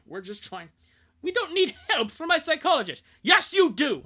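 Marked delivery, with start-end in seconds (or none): 1.2-3.0 put-on high and loud defiant teen; defiant shout. Kid refuses therapy. 3.2-4.0 high, loud parent's insistence; firm reply. Acted. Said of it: "Oh, wait, no, sorry".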